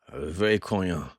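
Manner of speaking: put-on a grunted reply in a light Parisian accent. Henchman answering his boss, grunted in a Parisian accent. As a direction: grunt it, short, with a hint of Paris. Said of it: "his voice thick with a Parisian accent".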